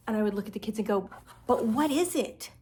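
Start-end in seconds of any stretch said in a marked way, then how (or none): 1.4-2.5 higher-pitched, playfully baffled question; exaggerated bewildered grown-up; hammed-up puzzlement. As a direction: play clueless for the kids. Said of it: "I would ham it up".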